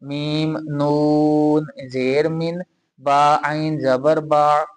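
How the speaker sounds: slow spelled-out मीम नून जेर मिन, then बा; Qaida letter spelling. Shown as a demonstration. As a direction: spell each letter slowly and clearly. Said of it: "कैसे?".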